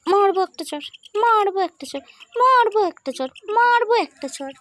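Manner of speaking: high, rising playful scolding, climbing each time; mock threat. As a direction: pretend to threaten the pet, pitch going up and up. (মজার হুমকি, প্রতিবার গলা আরও উঁচু)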